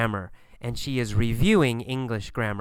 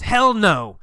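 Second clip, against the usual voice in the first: higher, louder hell no; emphatic refusal; 6 semitones higher, 6 dB louder than usual. Acted. Friend Gabriel refusing Kathy's library idea, loud. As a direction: throw out a blunt no.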